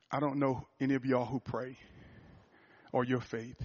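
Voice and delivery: low, soft, halting plea; hushed, sombre imitation; retelling a crying man. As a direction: quote a grieving man with long pauses, no sobs. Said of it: "And he's crying".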